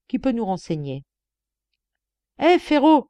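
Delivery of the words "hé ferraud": high, loud call across the yard. A narrator's reading. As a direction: hail the guard from afar. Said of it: "cria-t-il". (appeler en criant)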